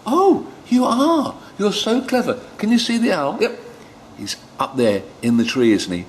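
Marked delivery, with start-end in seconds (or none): 0.1-3.6 delighted praise. Real, not acted. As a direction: cheer them on warmly. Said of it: "praising children".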